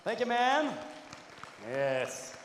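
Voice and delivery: ordinary talk, nothing marked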